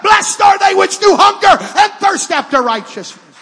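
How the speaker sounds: rising from firm to shouted preaching; building to full-force preaching. Acted. Preacher to congregation, climbing into a shout. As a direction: build it up till you are shouting the gospel.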